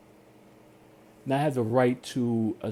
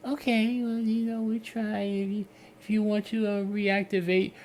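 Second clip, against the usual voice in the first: higher, sing-song company voice; pleading rep; 9 semitones higher than usual. Retold or imitated. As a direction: coax him back like a rep.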